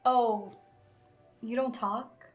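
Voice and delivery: normal talking voice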